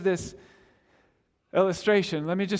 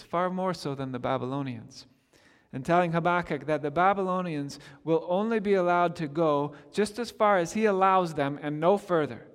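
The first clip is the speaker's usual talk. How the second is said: ordinary talk, nothing marked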